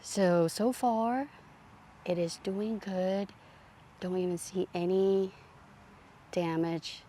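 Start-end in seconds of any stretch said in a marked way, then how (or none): none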